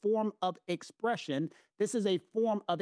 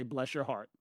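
lower, softer Southern "Bless your heart" demo; 6 semitones lower, 4 dB quieter than usual. Shown as a demonstration. Showing her co-host the Southern phrase, low and soft. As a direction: say it sweetly Southern with a little bite. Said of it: "You say".

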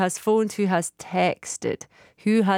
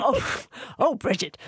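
mock scandalised; 3 semitones higher, 3 dB quieter than usual. Real, not acted. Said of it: "Oh, you are naughty".

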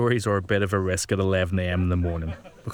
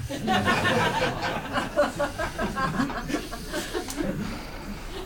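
high mocking laugh; 4 dB quieter than usual. Mocking laugh after a joke.

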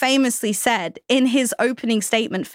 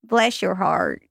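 trying Southern accent; 3 semitones lower, slower than usual. Shown as a demonstration. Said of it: "I'm getting there".

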